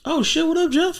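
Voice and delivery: high, bright shout-out; excited hello; excited greeting. Real, not acted. Greeting old coworker in chat.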